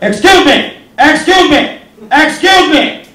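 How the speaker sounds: loud, high-pitched heckler's holler; Edwardian heckler. Retold or imitated. Comic heckling the wrestler walking to the ring, loud Edwardian heckler. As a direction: call out sharply as an indignant period gentleman. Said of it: "I did the Edwardian heckler at the wrestling".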